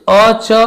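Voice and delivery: अ and च read separately, skipping the र; letters read apart. Shown as a demonstration. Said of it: "ऐसे नहीं पढ़ना".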